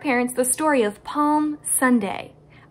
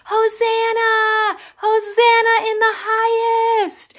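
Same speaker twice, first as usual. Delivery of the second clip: higher, louder, slow cheer; joyful yell; 11 semitones higher, 7 dB louder, slower than usual. Acted. Crowd cheering Jesus into Jerusalem, slow joyful yell. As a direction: shout it out like a crowd welcoming a king. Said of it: "and they yelled".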